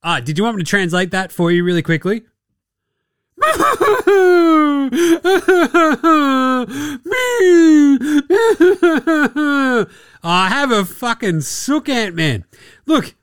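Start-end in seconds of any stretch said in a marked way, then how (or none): 3.4-9.8 long, high, loud helpless laughter; laughing fit. Real, not acted. Host cracking up at his own joke, laughing hard. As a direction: lose it completely laughing. Cracks up after mock translation.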